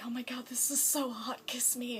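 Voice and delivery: Amy whispering playfully to the Doctor; breathy swoon. Retold or imitated. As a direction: whisper it like a smitten flirt. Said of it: "she's just kinda like".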